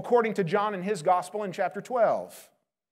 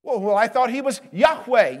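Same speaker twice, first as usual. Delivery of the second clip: normal talking voice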